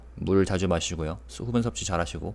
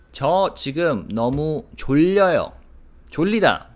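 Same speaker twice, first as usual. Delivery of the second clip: animated example; 7 semitones higher, 6 dB louder, faster than usual. Shown as a demonstration. Sudden loud Korean example sentence.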